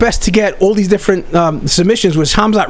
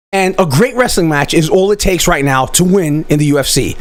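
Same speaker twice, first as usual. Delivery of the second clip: ordinary delivery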